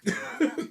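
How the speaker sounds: short, high, airy chuckle; laughing. Real, not acted. Teller tickled by his own joke, laughing. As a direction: let out a small high giggle.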